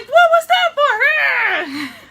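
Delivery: high, slow, laughing outrage; drawn-out, squeaky what was that for; indignant surprise. Retold or imitated. Wizard hit by spell.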